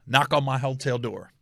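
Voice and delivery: ordinary talk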